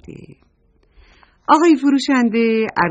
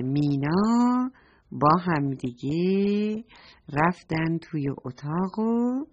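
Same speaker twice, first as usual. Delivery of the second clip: plain reading voice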